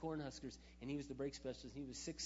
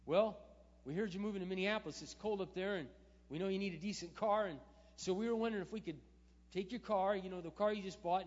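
eager offer; 6 semitones higher, 10 dB louder than usual. Retold or imitated. Coworkers voiced offering car repair.

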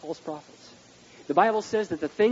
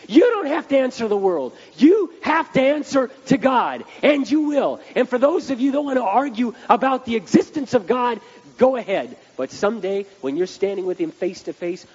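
much higher and louder preaching, driving every line; fiery preaching; 9 semitones higher, 9 dB louder than usual. Acted. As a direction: preach it like a revival sermon. Preacher at full force.

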